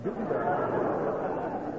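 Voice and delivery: whispered, drawn out slowly under his breath; whispered mumble. Acted. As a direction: trail off into a slow whisper. Witness mumbles as he leaves.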